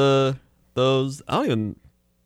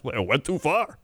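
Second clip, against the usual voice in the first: higher mock Chicago delivery; Chicago guy catchphrase; 5 semitones higher, 4 dB quieter than usual. Comic applying the commercial line to basketball, high Chicago accent. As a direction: tack on the ad catchphrase in Chicago twang. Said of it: "say it about every".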